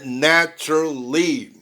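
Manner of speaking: slow "Naturally" as na-tru-ly; three distinct beats on "Naturally"; reduced "Naturally", no full "ral"; native three-syllable naturally. Shown as a demonstration. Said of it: "ouve três sílabas".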